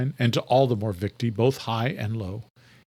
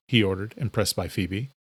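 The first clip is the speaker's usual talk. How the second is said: ordinary delivery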